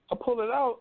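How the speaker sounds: normal talking voice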